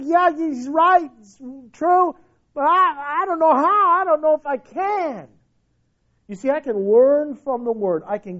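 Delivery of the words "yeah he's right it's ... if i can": higher, pleading voice of a doubter; struggling believer. Acted. Preacher voicing a believer torn before God, pleading and higher. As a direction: voice the honest doubt of someone who can't let go. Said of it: "I say".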